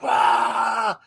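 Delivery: high, loud mock cheer; crowd roar. Restless crowd cheering the band's name, loud mock roar. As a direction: yell it like a whole arena cheering.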